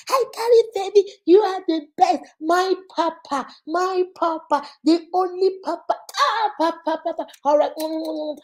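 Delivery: high, loud squeals of joy; mock side chick shrieking over money; shrieking with delight. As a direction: scream thanks like you just won big.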